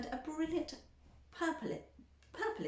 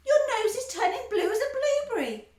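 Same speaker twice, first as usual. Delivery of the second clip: high, loud alarmed cry; panicked; 14 semitones higher, 10 dB louder than usual. A narrator's reading. Mother screaming.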